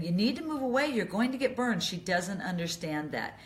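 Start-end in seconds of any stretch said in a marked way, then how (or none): 0.0-1.8 higher, anxious mock warning to mom; a lightly acted, worried telling-off; anxious scolding. Shown as a demonstration. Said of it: "getting anxious with her and saying".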